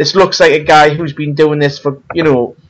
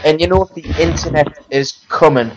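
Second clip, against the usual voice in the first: usual speaking voice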